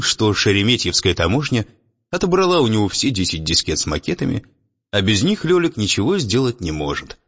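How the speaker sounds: ordinary delivery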